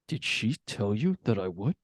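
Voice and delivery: lightly voiced quiet menace; a hint of sinister Jack; low mock threat to his son; menacing Jack. Retold Jack line, far timbre.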